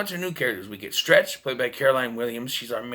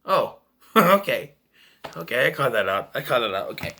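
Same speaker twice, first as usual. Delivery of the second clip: laughing reply; 2 semitones lower than usual. Retold or imitated. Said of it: "I'm like".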